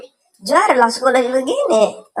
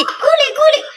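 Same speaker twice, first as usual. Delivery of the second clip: very high mimicked bird cry; kuliki bird call. Shown as a demonstration. Host mimics boss's kuliki call, crying high. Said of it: "suara kuliki".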